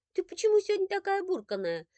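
high playful pet-name tease to a sulky daughter; teasing mother. Acted. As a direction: tease her sweetly up high.

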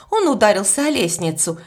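usual speaking voice

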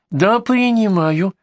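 high, drawn-out granny voice; old woman. Acted. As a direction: answer slowly as an elderly patient. Old patient answers doctor.

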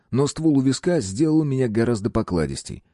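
ordinary delivery

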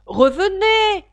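loud, high, drawn-out shout; shouted call. A narrator's reading. Caterpillar calling Alice back, shouting. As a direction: call out sharply after someone leaving. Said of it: "lui cria la chenille".

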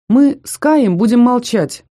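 slow, loud, assured promise; confident. A narrator's reading. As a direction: state it firmly and boldly. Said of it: "уверенно произнёс Рыжий".

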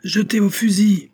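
high, loud battlefield shout; shouted command. Acted. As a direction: yell it without breaking stride. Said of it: "cria le colonel".

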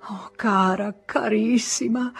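tearful. Acted. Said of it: "con il pianto nella voce".

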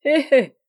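high, loud "hé hé" laugh; acted laughing. A narrator's reading. Yann mocks the man he hit, high acted laughter. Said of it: "Yann riait à se tordre".

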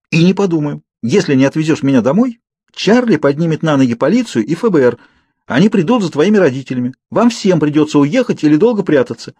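high, heated, insistent argument; defiant threat. A narrator's reading. Girl warning the Cullens to take her home, defiant.

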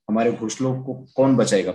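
ordinary delivery

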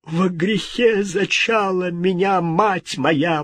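high, drawn-out lament; frightened prayer. A narrator's reading. King confessing to God in fear, plaintive. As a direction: pray high and slow in dread. King praying in terror before a ghost.

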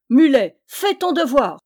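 very loud, high bellowed command; thundering yell. A narrator's reading. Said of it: "hurla Yann d'une voix de tonnerre". (hurlé d'une voix de tonnerre)

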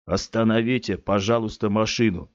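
ordinary delivery, nothing marked

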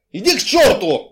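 loud, high angry outburst; yelled curse, pitch jumping up; furious yell. A narrator's reading. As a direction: bellow it back in a quarrel. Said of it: "заорал Гришка".

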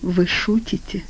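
softly, slowly, with mild sadness; quiet understated dejection; sad. A narrator's reading. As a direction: let it drop, a little hurt. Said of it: "грустно сказала девушка".

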